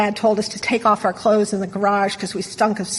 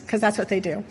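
normal talking voice

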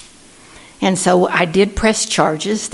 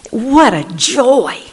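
slow, higher delighted sigh; joyful; 5 semitones higher, 4 dB louder, slower than usual. Real, not acted. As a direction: savor it with joy. Fond memory of teaching.

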